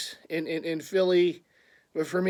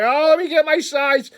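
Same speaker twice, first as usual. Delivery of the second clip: panicked excitement; 9 semitones higher, 8 dB louder than usual. Retold or imitated. Panic buying the hat.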